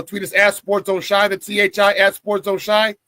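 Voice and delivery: slow, loud handle read; emphatic handle. Acted. Host spelling the Twitter handle for viewers, slow and emphatic. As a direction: spell it out slowly and firmly.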